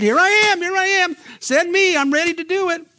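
high excited shout of a volunteer; eager volunteer. Retold or imitated. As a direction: play the overkeen volunteer, hand up.

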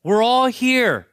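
high, loud calling out to the jailer; Paul yells out. Retold or imitated. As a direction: shout it across the dark prison so he stops. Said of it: "Paul yells out".

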